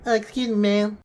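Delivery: high, soft-voiced impression; a big guy with a small, high voice; Mike Tyson. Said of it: "that was my Mike Tyson impression".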